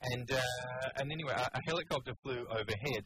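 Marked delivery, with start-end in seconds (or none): none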